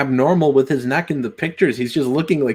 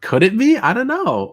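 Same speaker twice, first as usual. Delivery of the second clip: mock wonder; 10 semitones higher than usual. Echoing a joke in mock wonder.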